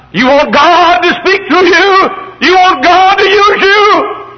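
high, loud pulpit shout; fiery, high-pitched sermon cry; full-force preaching. Real, not acted. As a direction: exhort the congregation at the top of your voice.

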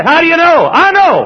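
rousing pulpit exchange; fervent conviction. Acted. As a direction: proclaim it with total certainty. Preacher's fervent question and answer.

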